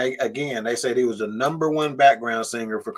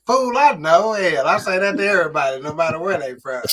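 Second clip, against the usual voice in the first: higher, louder retort, playfully indignant; mock exasperated comeback; 4 semitones higher, 4 dB louder than usual. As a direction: brush off the correction with mock outrage. Retold comeback to being corrected.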